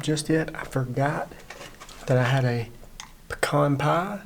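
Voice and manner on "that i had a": ordinary talk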